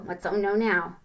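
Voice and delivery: ordinary delivery, nothing marked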